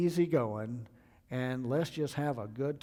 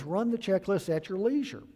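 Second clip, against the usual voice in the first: higher, louder mocking take of "leisure"; mock easygoing captain; 6 semitones higher, 5 dB louder than usual. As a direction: lampoon his casual order. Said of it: "trying to be easy, buddy".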